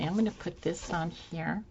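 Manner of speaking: ordinary talk, nothing marked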